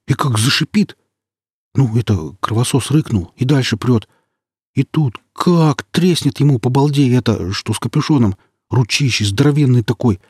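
high excited storyteller, spooked; jittery young guy retelling a scare; nervous companion voice. Acted. Companion retells monster encounter.

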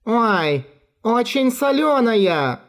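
high, slow soaked squeal; sputtering cry. Acted. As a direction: squeal while spitting seawater. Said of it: "закричала промокшая до нитки Чайка".

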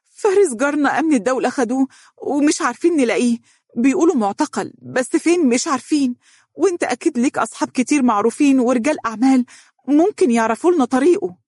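high-pitched, crying plea; tearful. A narrator's reading. Wife asks husband to find arrested neighbour, tearful. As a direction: pour out the news close to tears. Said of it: "قالت بصوت باكٍ".

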